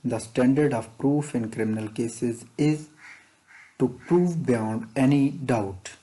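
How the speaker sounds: slow, deliberate dictation pace; teacher reading an exam question slowly. Shown as a demonstration. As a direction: read it out word by word for students to follow. Reads exam question text aloud.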